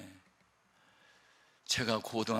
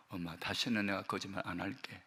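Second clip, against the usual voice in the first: softer, lower, contrite; quiet confession; 6 semitones lower, 8 dB quieter than usual. Retold or imitated. Kneeling boy confessing to his mother, quiet. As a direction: confess meekly on your knees.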